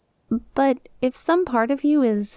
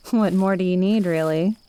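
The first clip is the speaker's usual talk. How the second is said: usual speaking voice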